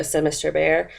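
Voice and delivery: usual speaking voice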